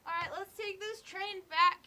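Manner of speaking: high cheery voice, drawn out; bubbly tipsy girl voice; chirpy party girls. Acted. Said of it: "They're like".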